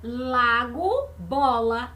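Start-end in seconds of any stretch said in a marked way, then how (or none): none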